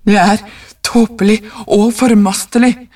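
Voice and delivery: plain reading voice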